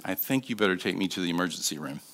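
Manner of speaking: low, soft and quick, understated; very calm. Retold or imitated. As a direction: say it calmly, without alarm. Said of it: "very calmly said".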